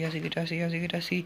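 normal talking voice

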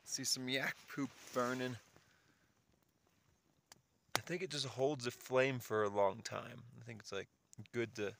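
normal talking voice

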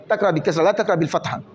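ordinary delivery

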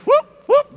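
high, loud whoop whoop like cracking a whip; whooping whip call; whip cracking noises. Pretend. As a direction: yelp it out as if driving workers hard. Mimes whipping the crew along.

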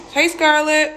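loud, high hello to a dog; high pet greeting. Real, not acted. Owner introducing the second dog, high loud greeting. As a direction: call out to your dog up high.

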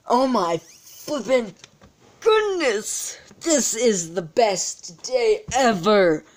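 high, slow, gushing joy; euphoric. Real, not acted. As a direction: savour every word in delight. Euphoric over the pulls.

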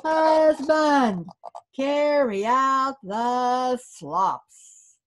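high, loud, slow call across the house; wife calling her husband to carry the slop, a loud slow call. Acted. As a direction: call him out, drawn slow. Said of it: "she called her husband".

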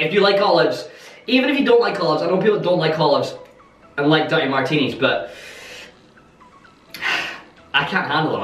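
ordinary talk, nothing marked

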